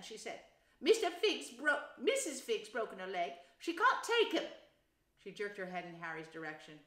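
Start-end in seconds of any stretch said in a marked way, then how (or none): 0.8-4.5 high, loud, angry worry; agitated, pitched up, peaking at the end; Aunt Petunia. A narrator's reading. Wife upset that the sitter cancelled, angry and worried. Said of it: "looking both angry and worried".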